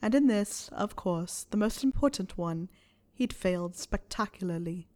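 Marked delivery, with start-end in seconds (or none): none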